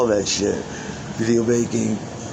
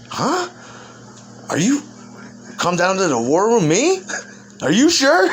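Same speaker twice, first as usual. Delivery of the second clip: high, rising incredulous questions; mock disbelief; 9 semitones higher than usual. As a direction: act dumbfounded, pitch shooting up on each question. Acting out Satan's disbelieving questions.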